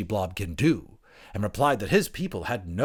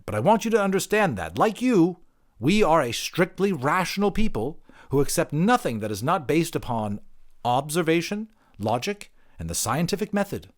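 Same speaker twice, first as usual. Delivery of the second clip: higher, quicker, insistent; prim creature; 9 semitones higher, 5 dB louder than usual. Acted. Creature lecturing the anthropologist on science, higher and insistent. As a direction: lay down the rules primly.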